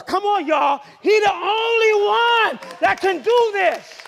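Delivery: high, loud rallying shout; fervent pulpit cry; full-force preaching. Real, not acted. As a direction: rouse the congregation with stretched words.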